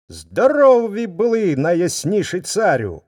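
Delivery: high, loud flattering greeting; bow and flatter the king; fawning wolf greeting. Acted. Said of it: "низенько вклоняється".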